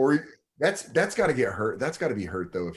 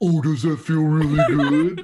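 loud, higher mocking imitation; exaggerated teasing repeat; 5 semitones higher, 7 dB louder than usual. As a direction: throw it back in a jeering voice. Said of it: "say it back in the mocking tone".

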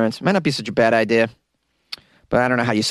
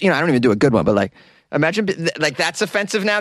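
ordinary delivery

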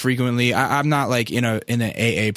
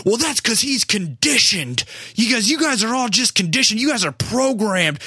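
condescending lecture; 9 semitones higher than usual. Retold or imitated. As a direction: talk down to them like sheep, pitched up. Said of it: "the other guy goes".

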